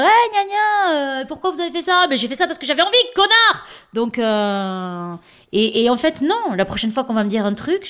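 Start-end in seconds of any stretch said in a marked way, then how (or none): 0.0-2.1 higher, louder mocking whine; hammed-up nagging voice. 2.1-3.6 louder snapping retort ending in a yell; mock furious comeback; insolent retort. Acted quarrel reply.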